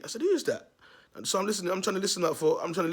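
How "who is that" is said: high shout toward the street; calling out. Retold or imitated. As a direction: yell out who's there. Calling out from front door.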